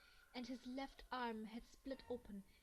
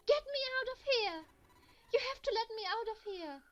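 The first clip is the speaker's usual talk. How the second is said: much higher, much louder frantic pleading; desperate screaming; 11 semitones higher, 15 dB louder than usual. Acted. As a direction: scream at the locked door to be freed. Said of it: "She screamed at the door".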